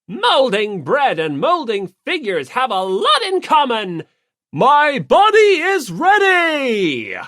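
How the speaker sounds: loud, rising to a slow, high bellow; shouted. Acted. Yamada grabbing the dough from Fujisaki, loud fired-up shout. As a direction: yell it like a fired-up otaku.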